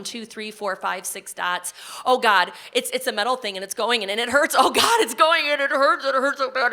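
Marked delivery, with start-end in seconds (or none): 4.6-6.7 higher, louder pained panic; reliving the pain. Retold or imitated. Patient reliving painful exam, pitched up in pain. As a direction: cry out as if it hurts now.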